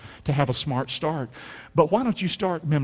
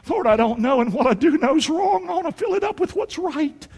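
pleading prayer; 9 semitones higher than usual. Acted. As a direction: pitch climbing steadily into a high plea. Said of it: "Talk to him about it".

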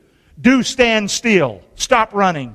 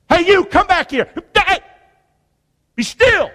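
calling out; 8 semitones higher than usual. Pretend. Preacher calling a panicked runner back. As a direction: yell after him to come back and be still.

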